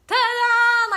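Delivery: like a magician finishing a trick. Pretend. Vlogger plays a magician revealing thought put on paper, loud reveal. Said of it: "I'm like".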